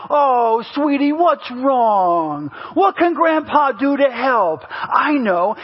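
slow, drawn-out cooing grandpa; doting grandpa. Retold or imitated. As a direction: imitate a grandpa soothing a grandchild. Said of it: "it becomes a grandfather".

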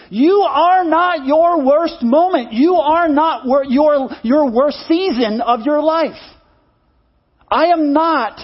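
slow, emphatic preacher's declaration; preaching. Acted. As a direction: proclaim it from the pulpit. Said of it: "I'm up here waving my hands and preaching".

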